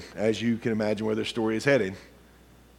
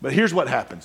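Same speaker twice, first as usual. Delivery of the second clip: ordinary talk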